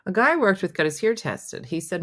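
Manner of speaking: normal talking voice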